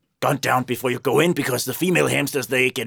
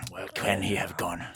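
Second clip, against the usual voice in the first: whispering; 5 semitones lower, 10 dB quieter than usual. Acted. Hunter whispers while searching.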